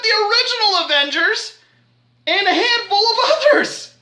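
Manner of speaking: mock-distraught cries of disbelief; hammed-up horror. Reviewer mourning heroes turned to dust, hammed-up panic.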